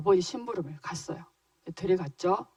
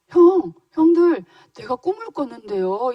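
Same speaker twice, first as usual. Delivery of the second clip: eager younger brother calling out, higher and louder at first; 4 semitones higher, 7 dB louder than usual. Acted. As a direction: call your brothers over to hear your news. 요셉이 형들에게 꿈 얘기. (형들을 부르는 들뜬 동생 목소리)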